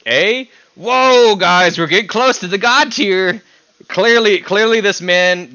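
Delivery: high, loud and thrilled, hyping chat up; excited outburst. Real, not acted. Streamer hyping chat over the guest's A rating, loud and excited. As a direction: cheer it like a big reveal.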